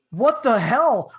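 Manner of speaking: slow, loud alarmed outburst; startled and spooked; shocked. Retold or imitated. Startled by shaking bed.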